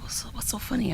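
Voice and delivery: hushed whisper, barely voiced. Billy whispering to his friend in the class line, hushed. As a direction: lean in and whisper the question.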